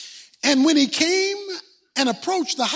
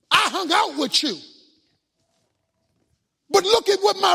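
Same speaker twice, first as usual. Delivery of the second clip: put-on angry older son; 3 semitones higher, 4 dB louder than usual. Older son shouting at his father over the party, angry. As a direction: rail at your father, wounded and furious. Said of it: "But he answered and said to his father".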